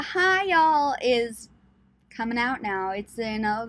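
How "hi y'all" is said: a light southern "Hi, y'all" pitched higher; a hint of a Southern accent sneaking out. Shown as a demonstration. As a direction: drop into a Southern US drawl on the greeting. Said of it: "my southern accent has been slowly progressing".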